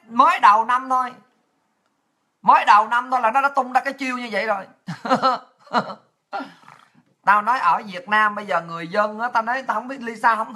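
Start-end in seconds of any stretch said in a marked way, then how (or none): 4.9-5.4 short laugh. Real, not acted. Laughs at the rumour.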